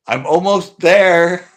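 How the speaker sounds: loud, high, drawn-out call; calling out warning. Retold or imitated. Driver calling loudly to the couple in back, slow and high. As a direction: stretch it out loud to warn them.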